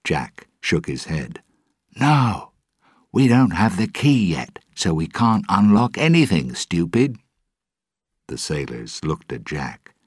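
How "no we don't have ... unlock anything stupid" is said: raised, scolding and fed up; exasperated. Acted. Jack snapping at his crew, exasperated. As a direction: talk down to them like fools.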